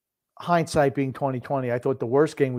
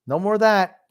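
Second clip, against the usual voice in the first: mildly mocking quote, higher and louder; mock surrender; 8 semitones higher, 3 dB louder than usual. Co-host imitating the Flyers giving up on fighting, mock surrender. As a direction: play up the other team backing down.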